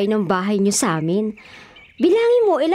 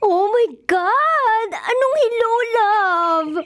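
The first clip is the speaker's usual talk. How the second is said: very slow, higher oh my God; scandalized gasp; 8 semitones higher, much slower than usual. Acted. Friend overhears the secret love call, stretched shocked gasp.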